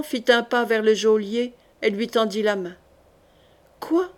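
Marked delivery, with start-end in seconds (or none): 3.8-4.1 high, rising astonished question; dumbfounded. A narrator's reading. Jailer offered the knight's hand, dumbfounded. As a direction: blurt it in stunned disbelief. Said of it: "fit l'homme abasourdi".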